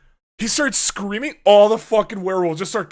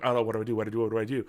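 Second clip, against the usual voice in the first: retold fretting; 6 semitones lower, 6 dB quieter, much faster than usual. Acts out panicked muttering while cornered.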